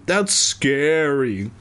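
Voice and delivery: slow, drawn-out mock awe; theatrically slow exclamation; exclaimed. A narrator's reading. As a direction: stretch it out with put-on dread. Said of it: "he exclaimed".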